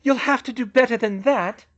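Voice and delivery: high, loud taunt; calling out. A narrator's reading. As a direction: call it across to your enemy. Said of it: "she called".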